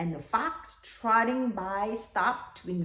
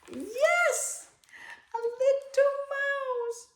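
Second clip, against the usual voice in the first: much higher, delighted yes; delighted praise; slower than usual. Acted. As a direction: cheer the kids' right answer. Praising children's answer.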